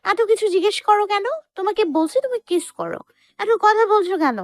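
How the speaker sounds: put-on very high, cross girlfriend voice; acted anger. A narrator's reading. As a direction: scold him impatiently, pitched very high. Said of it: "তখন মায়া রেগে বলল". (রাগী প্রেমিকার চিকন গলা)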